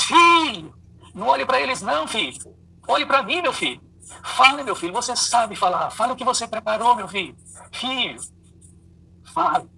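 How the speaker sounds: high, loud call across the hall; father shouting from the back. Acted. Father calling to stuttering son from hall door, high loud shout. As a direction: yell to the son over the crowd, urging. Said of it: "E ele gritou lá de trás".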